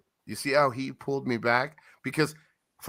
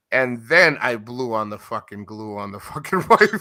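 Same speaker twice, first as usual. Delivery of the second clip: breaking into laughter mid-line; cracking up telling the pipe story; laughing; 5 semitones lower, 4 dB louder than usual. Real, not acted. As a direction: laugh through the punchline.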